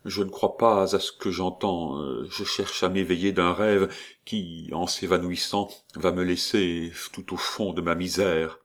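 ordinary delivery

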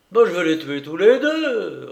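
loud, rising threat; roaring. A narrator's reading. Enraged husband resolving on a double killing, roaring. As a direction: roar it out, pitch lifted. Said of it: "rugit Papillot".

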